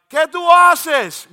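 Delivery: high, loud, drawn-out holler in Spanish; screamed question. Retold or imitated. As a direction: yell it slowly across to the neighbor. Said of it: "I would scream at Adrian".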